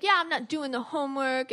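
lightly voiced defiant student; poser kid. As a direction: imitate a disrespectful seventh grader refusing. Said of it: "he was, like, a poser".

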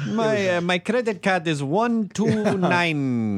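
slow, wandering attempt at South African; botched South African accent. Imitating a South African customer, botched accent. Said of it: "What is that accent?".

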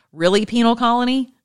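affronted questioning jab; mock indignation. Retelling own retort to Australian doctor.

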